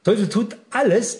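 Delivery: normal talking voice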